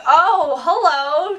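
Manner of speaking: mildly hammed-up starstruck greeting; retold surprised greeting. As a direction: replay a pleasantly startled hello. Own greeting to actor, retold.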